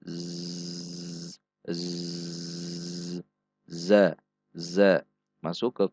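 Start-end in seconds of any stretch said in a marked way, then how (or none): 0.0-3.2 very soft buzzing "Z", friction at the teeth; "z" sustained quietly with friction; hissed buzzing z. Shown as a demonstration. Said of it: "Ada gesekan pada titik makhraj". 3.7-5.1 "za" with buzzing friction; clear za. Shown as a demonstration. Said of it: "Ada gesekan".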